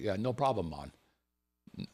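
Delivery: put-on a light Jamaican accent, laid-back. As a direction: toss it off like a relaxed Jamaican local. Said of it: "that's my Jamaican accent".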